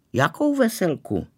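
high-pitched, puzzled question; surprised. A narrator's reading. Girl asked by her classmate about a wedding, surprised. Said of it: "divila se".

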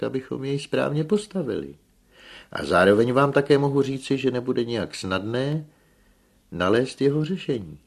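high, loud official explanation; insistent expert voice; engineer lecturing. A narrator's reading. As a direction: address the sceptical room firmly.

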